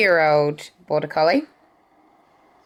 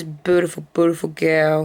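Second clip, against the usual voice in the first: usual speaking voice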